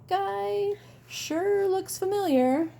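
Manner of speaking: high, slow puzzled voice; puzzled remark. Acted. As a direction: squint at the familiar face. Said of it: "looking at him and saying".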